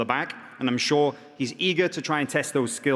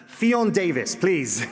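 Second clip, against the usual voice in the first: raised voice, calling the name out; announcer call; 4 semitones higher, slower than usual. Real, not acted. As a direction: call her name out to the room. Announcer calls fighter to stage.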